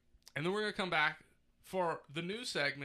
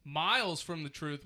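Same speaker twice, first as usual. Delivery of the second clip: announcer; 5 dB louder, slower than usual. Acted. Announcing news segment title.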